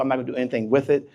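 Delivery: ordinary delivery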